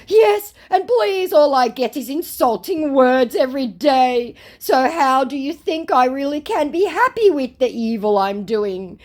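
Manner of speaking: high, loud theatrical lament; hammed-up poor-me wail; mock anguished plea. Scammer complains of daily insults, mock anguish.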